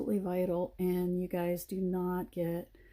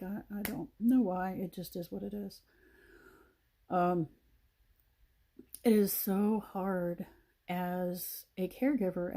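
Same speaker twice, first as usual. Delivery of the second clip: usual speaking voice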